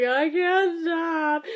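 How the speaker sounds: mock crying. Retelling the sisters' speeches, drawn-out mock crying. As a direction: stretch it out like helpless crying. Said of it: "I can't make it stop".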